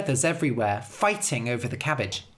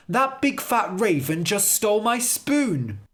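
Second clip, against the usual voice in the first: outraged; 6 semitones higher than usual. A narrator's reading. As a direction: cry out in disbelief. Girl outraged at raven.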